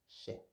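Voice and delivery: chet with a soft sh like French chat; sh sound. Shown as a demonstration. Teacher models chet with a French ch sound. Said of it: "se prononce ch".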